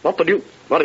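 flustered stammering, tripping over words; stuttering. Acted. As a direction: stammer it out in shock. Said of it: "turns stuttering to his secretary".

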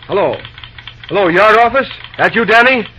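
calling on the phone. Acted. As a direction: call out over a bad line. Calling yard office by phone.

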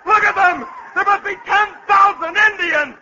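very high, loud, panicked orders; frantic battle cry. Acted. General rousing Colonel and men to arms, frantic high shouting.

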